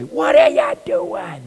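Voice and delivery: imitating a coach screaming at a player leaving the field; mock coach yell. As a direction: yell it like a furious coach at a runner thrown out. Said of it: "You've seen coaches do that".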